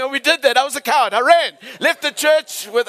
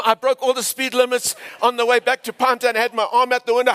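ordinary talk, nothing marked

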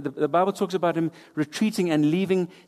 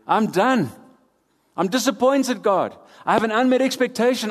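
mock despairing complaint; 5 semitones higher than usual. Voicing Elijah's complaint to God.

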